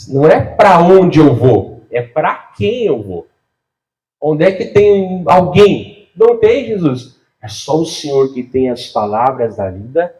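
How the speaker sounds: usual speaking voice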